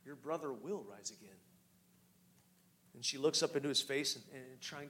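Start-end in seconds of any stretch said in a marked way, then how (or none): 0.0-1.4 soft, lower and gentle; tender. Acted. Jesus comforting grieving Mary, tender and soft. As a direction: comfort her quietly. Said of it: "he says tenderly".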